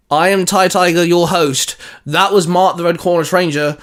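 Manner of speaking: normal talking voice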